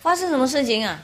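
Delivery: mock-alarmed mum asking what happened; mother hears her son won't go to school, startled; mother surprised. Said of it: "妈妈说".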